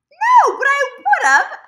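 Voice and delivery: high, loud, drawn-out protest; retold loud reply. Replying to his question about fries, loud high protest. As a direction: throw it out high and very slowly.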